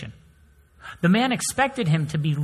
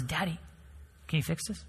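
put-on much softer, small child voice; child asking; 11 dB quieter than usual. Daughter asking her dad to fix her doll, soft child voice. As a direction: ask softly like a little girl. Son with broken sword.

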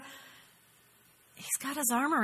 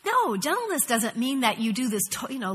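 normal talking voice

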